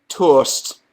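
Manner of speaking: toast with a Geordie vowel, toast said like tawst; Geordie accent. Pretend. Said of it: "Say toast in a Geordie accent".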